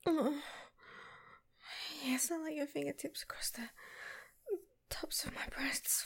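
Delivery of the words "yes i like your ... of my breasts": breathy whisper. Acted. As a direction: whisper it, aroused.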